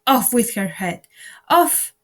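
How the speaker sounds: furious scream rising to a shriek. A narrator's reading. Queen in fury screams at Alice, loud furious scream. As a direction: scream it at the top of your lungs.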